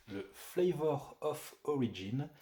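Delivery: put-on slow, drawn-out English accent. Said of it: "Bravo pour l'accent".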